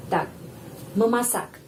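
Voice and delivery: ordinary delivery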